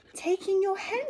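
high bright voice for the wise man; wise old sage. Acted. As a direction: give the advice cheerfully. Wise old man's advice.

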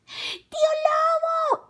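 very high, drawn-out shout; insistent girl. A narrator's reading. Carmela insisting at the door, high loud call. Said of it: "insistió Carmela".

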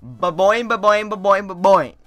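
bouncy cartoon spring sound; imitated boing noise.

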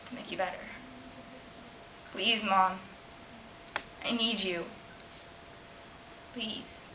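low, hushed, desperate plea; soft pleading. Acted. Girl begging her mom, low and pleading. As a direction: fade into a small, pleading voice.

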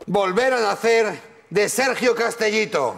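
slow, high theatrical declaiming; hammy stage actor. Shown as a demonstration.

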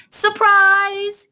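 high, loud, drawn-out shout; surprise cry. Acted. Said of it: "came the cry".

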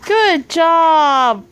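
loud, high cheer; proud auntie cheering the little one's handwriting; big praise. Real, not acted. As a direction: celebrate her loudly.

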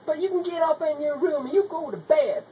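ordinary talk, nothing marked

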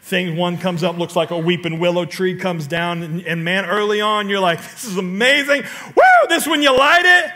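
usual speaking voice

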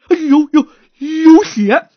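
loud, high yelp of fright; frightened cry. Acted. Stutterer feels the corpse's head, yells in fright. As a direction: jump at the sight of blood. Stutterer finds blood, cries out.

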